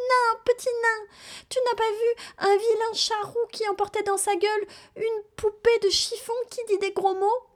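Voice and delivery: put-on a lost girl pleading with the dwarf; very high pleading child's voice; little girl voice.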